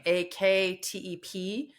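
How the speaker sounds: letter by letter, slow; spelled out slowly. Shown as a demonstration. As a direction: spell each letter deliberately. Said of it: "looks like actep".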